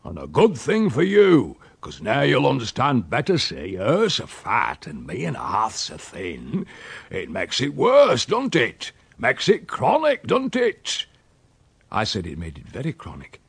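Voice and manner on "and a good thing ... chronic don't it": emphatic country dialect, rising higher; rustic old uncle, indignant. Acted. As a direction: argue the grievance like an old countryman.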